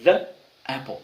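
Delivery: the apple with a quick d-like th; the apple com th rápido; model the th. Shown as a demonstration. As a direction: say the apple, th fast like d. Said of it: "Pega a maçã que eu te falei".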